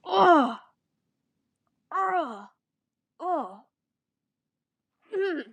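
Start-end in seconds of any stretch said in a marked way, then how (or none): 0.0-3.6 real groans, loud and high at first, then fading; disgusted groan. Real, not acted. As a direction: groan at the cringe, dying off. Reader disgusted by the text.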